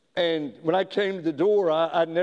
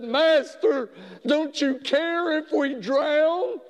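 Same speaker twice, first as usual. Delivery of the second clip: high, pleading cry of the disciples; frightened disciples calling to their sleeping master; terrified cry; 10 semitones higher, slower than usual. Acted. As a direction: cry out in fear of drowning.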